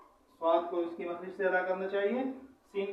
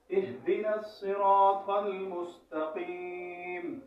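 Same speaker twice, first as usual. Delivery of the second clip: very slow, drawn-out Quranic recitation; connected recitation; much slower than usual. Shown as a demonstration. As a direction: recite it as one run with long held vowels. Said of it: "روا اس کو اس طرح پڑھیں گے".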